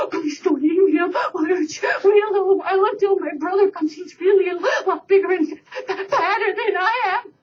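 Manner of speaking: high, begging goat voice; pleading little goat. Acted. Smallest goat begging the troll, high and pleading. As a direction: beg for your life, high.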